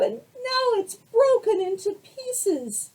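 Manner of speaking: high, loud, slow little bear; high baby bear lament; Baby Bear upset. Acted. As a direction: wail it like a tiny bear. Baby Bear's broken chair.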